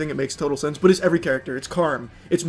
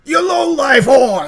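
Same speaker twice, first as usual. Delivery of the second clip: slow, full hammed-up shout, higher and louder; mock shout; 8 semitones higher, 6 dB louder, slower than usual. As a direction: escalate the insult into a yell. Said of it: "He's like".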